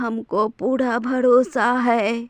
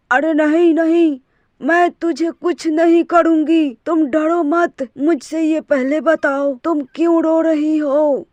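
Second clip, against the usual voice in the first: witch voice; 2 semitones higher, 4 dB louder than usual. Acted. Witch asking the weeping old woman, loud eerie voice. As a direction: call out like a prowling witch. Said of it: "चुड़ैल ... बोलती है".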